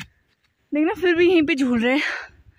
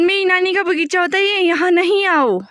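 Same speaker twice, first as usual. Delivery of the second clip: louder demo shout; 5 semitones higher, 3 dB louder than usual. Shown as a demonstration. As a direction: call it out big so the child copies. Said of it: "ऐसे बोलो".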